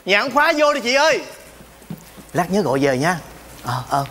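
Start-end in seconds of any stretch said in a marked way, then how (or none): 0.1-1.2 gọi vọng ra. Acted. Calling visitor in from inside.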